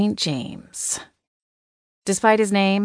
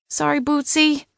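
higher-pitched apology to a pet; apologetic to a cat; 9 semitones higher than usual. A narrator's reading. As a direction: say sorry to the cat in a lifted voice. Narrator voices own apology to cat.

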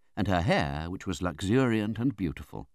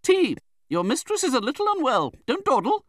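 very high, loud, brisk orders; imperious command; 6 dB louder than usual. A narrator's reading. Aunt orders tea from the servant, imperious.

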